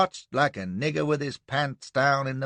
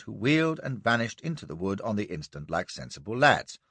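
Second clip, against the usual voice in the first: ordinary delivery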